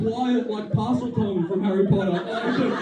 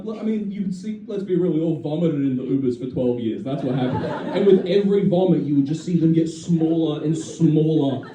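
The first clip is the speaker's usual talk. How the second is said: ordinary delivery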